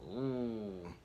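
ordinary delivery

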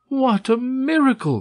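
high-pitched exclamation of wonder; marveling, voice leaping way up; amazed cry. A narrator's reading. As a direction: cry out in joyful astonishment. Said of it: "cried Candide".

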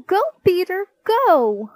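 high, loud cheer from the sidelines; mom's cheering shout. A narrator's reading. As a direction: yell on a child in a race. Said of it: "shouted Mom".

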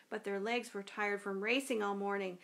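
ordinary delivery

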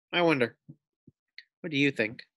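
usual speaking voice

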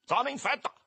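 high, loud soldier's bark; sharp, high-pitched military shout; yelled order. A narrator's reading. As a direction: bark it at the boys to clear off. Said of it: "urlò ai maschi".

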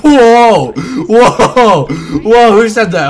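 loud, high startled whoa; surprised. Real, not acted. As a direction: react with shock.

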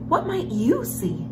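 ordinary delivery

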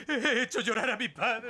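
high, teary voice breaking into a laugh; half laughing, half crying at his own guilt; starting to cry. Acted. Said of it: "lloro porque tú estás llorando".

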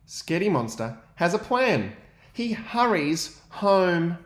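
put-on lively read-aloud for young children; story narration. A narrator's reading. Teacher reading to his class, lively storytelling. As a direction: read the picture book to the class with energy.

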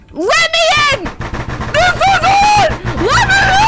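loud high pleading shout; yelling at the house to let them in, desperate. Pretend. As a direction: bang on the door and yell to be let in. Pounding to get in.

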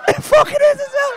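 very high, incredulous yell; astonished shout. Real, not acted. Comic amazed by a punter's name, shouting in disbelief.

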